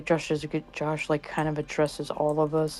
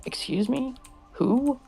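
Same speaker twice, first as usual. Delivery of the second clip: indignant disbelief; 9 semitones higher, slower than usual. Retold or imitated. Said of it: "I'm like".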